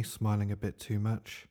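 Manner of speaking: plain reading voice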